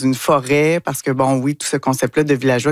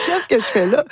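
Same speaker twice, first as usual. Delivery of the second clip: baffled; 8 semitones higher than usual. Retold or imitated. Retelling her confusion on set.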